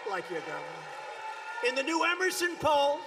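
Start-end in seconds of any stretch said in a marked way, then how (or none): 0.1-1.7 slow, teasing repeat; slow, teasing dig at the governor; sly jab. Acted. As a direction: drag it out as a jab.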